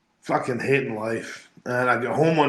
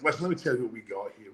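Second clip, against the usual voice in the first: rapid, slick salesman patter; fast sales pitch; 5 dB quieter, much faster than usual. Retold or imitated. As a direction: rattle it off like a hustler. Voicing the slick wrestling promoter.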